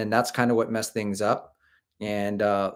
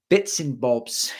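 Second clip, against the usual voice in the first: mock British bits and bobs; slower than usual. Tries British slang.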